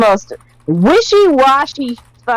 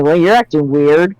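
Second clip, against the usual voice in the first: low, flat confused guy; lightly voiced low deadpan Cloud; 7 semitones lower than usual. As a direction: mutter it low and unimpressed.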